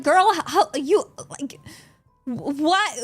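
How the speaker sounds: mock outrage. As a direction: tease her like she's an old mother. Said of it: "And I'm like".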